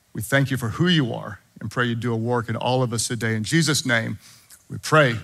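soft, hushed pastoral prayer. Acted. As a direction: pray quietly and sincerely over the church.

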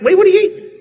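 sudden high loud question; a stranger startled by what he sees; shocked. Retold or imitated. Sinner noticing the preacher eating scraps, high startled question.

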